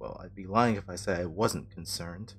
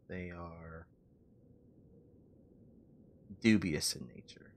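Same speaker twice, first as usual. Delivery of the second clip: whispered warning; 7 dB quieter than usual. Acted. Ominous aside about fiends.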